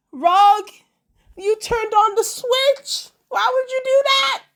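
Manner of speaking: loud, high hollering at the screen. Retold or imitated. Vlogger hollering at Rogue during the fight scene, loud and high. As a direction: yell at Rogue in alarm. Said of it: "I was hollering".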